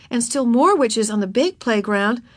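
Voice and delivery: usual speaking voice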